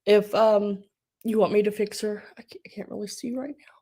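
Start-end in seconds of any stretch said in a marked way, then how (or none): 2.4-3.7 choked whisper while crying; tearful whisper. Real, not acted. Crying streamer whispering that she can't see. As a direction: whisper it, too teary to see.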